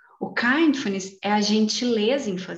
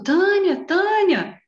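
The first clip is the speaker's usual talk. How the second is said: raised, higher calling-out of a name; mock shouting; 10 semitones higher, 6 dB louder, much slower than usual. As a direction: demonstrate losing control with a playful yell. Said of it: "eu tô gritando".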